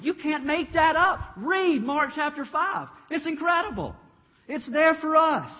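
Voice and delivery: excited preaching. Real, not acted. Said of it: "preacher builds excitement".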